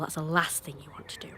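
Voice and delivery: soft whispered aside. Real, not acted. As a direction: drop to a whisper.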